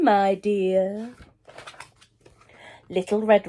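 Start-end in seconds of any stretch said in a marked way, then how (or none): 0.0-1.1 low sly wolf voice; deep scratchy voice. Acted. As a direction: answer low like a disguised wolf. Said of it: "deep, scratchy voice that answered".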